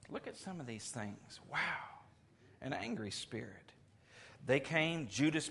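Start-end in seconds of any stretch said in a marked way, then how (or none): none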